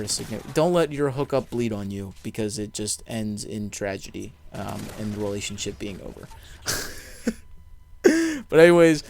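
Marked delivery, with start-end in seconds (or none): 6.3-8.5 voiceless, higher wheezing laugh; breathy laugh. Real, not acted. Laughing at own joke.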